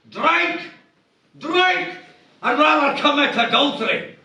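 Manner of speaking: high, shrieking outrage from a stern preacher; scandalised at the very idea of a drink; outraged minister. Acted. Outraged Free Kirk minister.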